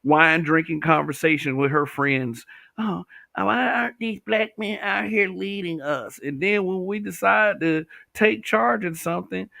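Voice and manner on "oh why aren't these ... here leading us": higher mocking voice of the women; mock quote. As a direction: sarcastically imitate their complaint.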